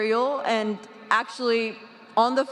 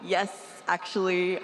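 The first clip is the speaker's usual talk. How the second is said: ordinary delivery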